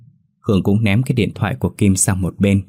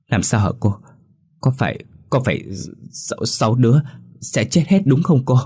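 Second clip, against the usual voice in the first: frightened question; 5 semitones higher than usual. Acted. Frightened girl asking about death.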